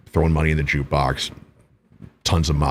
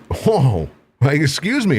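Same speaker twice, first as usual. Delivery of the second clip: indignant disbelief; 9 semitones higher than usual. Retold or imitated. Acts his reaction to insult.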